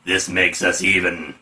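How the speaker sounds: low, slow hero voice; solemn proclamation. Acted. Die Hard proclaims to Strong Arm, low mock-solemn voice. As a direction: proclaim it deep and deliberate. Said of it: "Die Hard Cock proclaims".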